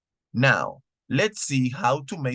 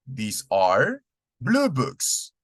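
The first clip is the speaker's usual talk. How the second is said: "These are blue books" said slowly, stressing "blue"; slow model answer; much slower than usual. Shown as a demonstration. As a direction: give the answer slowly and clearly. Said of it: "debe ser con these are".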